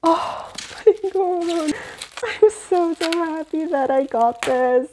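high, slow, breathless awe; gasp, then gush with joy; genuinely overwhelmed delight; awed gasp. Real, not acted. Gasping over the book.

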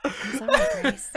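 high, loud laughing sorry; laughing apology. Acted. [name] fake-apologizes to Price, laughing high and loud. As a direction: apologize while cracking up.

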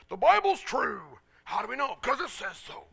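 loud, high, drawn-out proclamation; mock dogmatic insistence. Preacher mimicking every Bible study he attended, mock dogmatic. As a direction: belt it out like an unquestionable fact.

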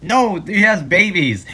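usual speaking voice

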